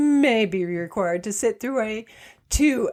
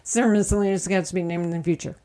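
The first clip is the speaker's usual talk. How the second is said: rattled off very fast; rushed fine print; 3 semitones lower, much faster than usual. Acted. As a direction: blurt the legal disclaimer fast. Repeats fine print even faster.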